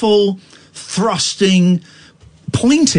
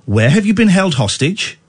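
usual speaking voice

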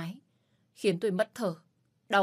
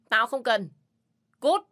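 higher, much louder dismissal; angry shout; 4 semitones higher, 8 dB louder than usual. Acted. Man yelling at the hotel staff knocking, angry. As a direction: bark it through the door.

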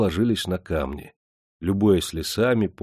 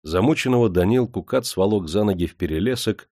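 ordinary delivery

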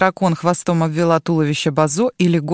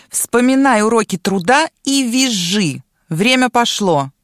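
loud bossy order from a dragon; commanding order; 4 semitones higher, 4 dB louder than usual. A narrator's reading. Dragon orders the girl on her back, loudly commanding. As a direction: bark the command, then slow down at the end. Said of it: "и приказала".

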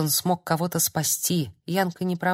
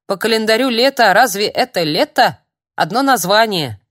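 ворчливо; 4 semitones higher, 7 dB louder than usual. Acted. Старик ворчит о погоде.

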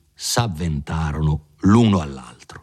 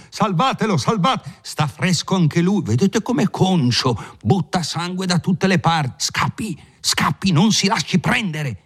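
much higher, agitated bystanders gossiping over the fallen; a chorus of horrified townsfolk, high and breathless; higher, faster frightened crowd chatter, urging him to run; excited crowd voices; 9 semitones higher than usual. A narrator's reading. Crowd of onlookers after the duel.

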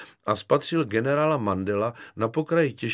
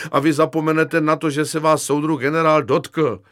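loud, raised, insistent; agitated overruling; 5 semitones higher, 8 dB louder than usual. Acted. Lieutenant forcing a deal on Kefalín, loud insistence. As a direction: lay down the law at full voice.

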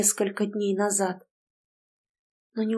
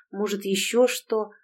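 ordinary delivery

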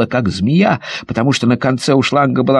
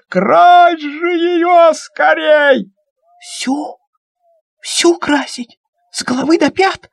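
much higher, stretched calls; excited urging; 13 semitones higher, slower than usual. Acted. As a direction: hurry them up in glee. Kids urging, high. (взволнованно, высоко)

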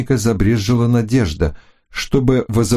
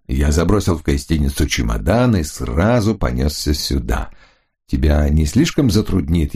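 lower, smooth charming man; lower, persuasive gentleman; man's voice; 5 semitones lower than usual. Acted. Hunter's voice, lower.

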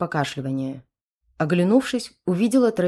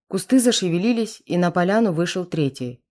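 plain reading voice

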